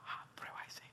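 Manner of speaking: a quick hushed whisper to a friend at the table; whispered aside. Retold or imitated. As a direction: lean in and whisper it. Susurra a Ligia en restaurante. (susurro rápido a Ligia)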